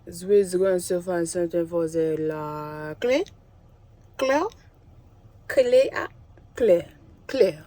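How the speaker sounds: playful fake French syllables; mock French. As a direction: toy with the French sounds.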